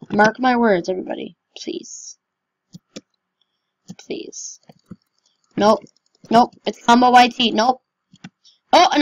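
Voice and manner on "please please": begging whisper; hushed pleading please. Real, not acted. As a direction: whisper the plea. Begging nobody punch him.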